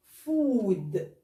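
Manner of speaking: فود with a drawn-out long oo. Shown as a demonstration. Teacher showing the class a long o. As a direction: stretch the vowel of فود very slowly. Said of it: "انا هنا عملت لونج او".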